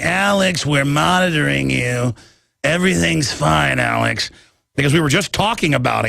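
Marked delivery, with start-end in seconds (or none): 0.0-4.3 slow, lightly put-on spy voice; a hint of a mysterious spy, drawn out; imitating the spy. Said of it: "And he talks like that".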